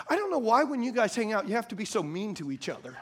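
quick, softened complaint; retold wife's reproach. Wife chiding husband about his friends, quick subdued reproach. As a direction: imitate a puzzled wife, rushed.

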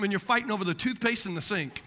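ordinary talk, nothing marked

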